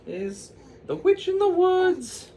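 very high, breathy-free squeaky princess voice; high falsetto character voice, slowing at the end. Acted. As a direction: squeak it up high like a cartoon heroine. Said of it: "she said".

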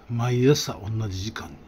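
normal talking voice

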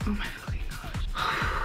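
whispered exasperation. Real, not acted. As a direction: breathe it out in disbelief. Exasperated breathy outburst.

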